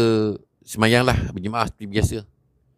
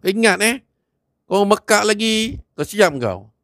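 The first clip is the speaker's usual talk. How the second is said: angry warning; 9 semitones higher than usual. Acted. A young man leaves Haji Hamid a threat, high and menacing. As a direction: leave him a last threat.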